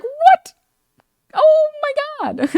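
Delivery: very high, loud, incredulous squeal; hammed-up high-pitched shock; mock disbelief. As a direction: react as if the accusation is absurd. Acted disbelief at racism accusation.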